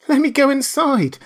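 high, loud frightened begging; terrified shriek, begging to hide; shrieking plea. Acted. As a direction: shriek to be let inside. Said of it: "shrieked aloud".

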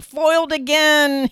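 high, loud hammed-up villain cry; mock villain howl. Beaten skier howls at his girlfriend, hammed-up villain. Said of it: "he howled".

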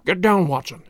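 understated, urgent order to a companion; urgent cry. A narrator's reading. Detective warning his companion to hide, mild cry. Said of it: "cried Holmes".

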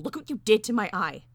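loud, angry accusation. A narrator's reading. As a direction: hurl the blame, raising the volume. Accusing the bird of injury.

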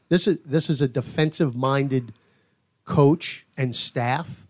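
ordinary delivery

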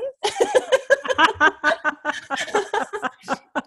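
long high laugh at her own joke; amused laugh. Real, not acted. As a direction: laugh freely at the pun.

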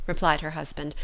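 ordinary delivery

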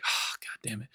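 hushed, resigned sigh of a curse; whispered mock exasperation; deflated reaction. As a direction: mutter it under your breath, let down. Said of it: "you're gonna be kind of like".

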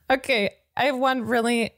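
ordinary talk, nothing marked

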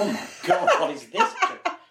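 loud, high-pitched imitation of horrified producers, laughing; mock panic. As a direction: act out aghast producers with a big jump in pitch and volume. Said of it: "the producers were all shitting themselves".

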